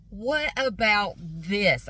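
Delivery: very slow, drawn-out mock offer; salesman's pitch. Salesman offering customer a new deal, mock enticing. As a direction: spread the words out like a grand proposal.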